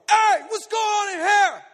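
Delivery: high, loud, drawn-out holler; acted challenging shout. Man banging on a door, shouting a challenge. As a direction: holler it through a shut door.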